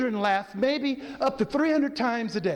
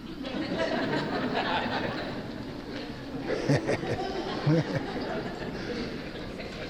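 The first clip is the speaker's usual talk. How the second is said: long breathy demo laugh; fake laughter; 5 semitones higher, 7 dB quieter than usual. Shown as a demonstration. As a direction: laugh for no reason, just to show it. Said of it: "what does your fake laughter sound like?".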